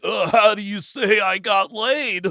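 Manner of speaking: put-on loud, slow dopey teen; goofy student voice. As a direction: ask it like a clueless kid. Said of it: "And he's like".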